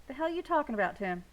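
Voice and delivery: loud, high, startled demand; sharp demand. A narrator's reading. Daddy demanding Tim explain: loud startled. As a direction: whip round and demand it.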